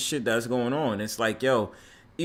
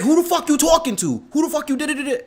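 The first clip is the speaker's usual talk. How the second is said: high, louder mock challenge; imitated angry confrontation; 12 semitones higher, 5 dB louder than usual. Strangers hop out of the store to confront him, angry imitation. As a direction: act out strangers challenging you, pitched way up.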